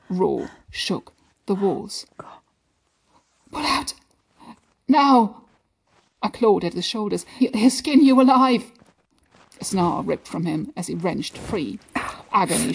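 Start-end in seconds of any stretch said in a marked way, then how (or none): 3.5-5.4 loud urgent command; urgent shout. Acted. Urgent warning as Silas arrives. 7.4-8.7 loud frightened warning; panicked shout. Acted.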